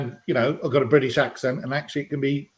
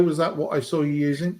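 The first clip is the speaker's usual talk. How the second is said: ordinary delivery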